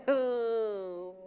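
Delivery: a quiet chuckle; soft laugh. Real, not acted. Preacher laughs softly during the skit. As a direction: laugh under your breath.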